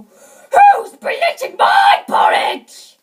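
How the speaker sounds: huge, slow roaring shout; great big bear voice. A narrator's reading. Father Bear at his empty bowl, great big voice. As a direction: boom it out like an enormous bear. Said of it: "he said in his great big voice".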